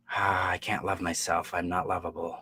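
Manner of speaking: mildly deflated, low and soft; dejected self-talk. Acted. Acting out someone who feels unlovable after disapproval, dejected. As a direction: sigh it out, sinking into quiet defeat.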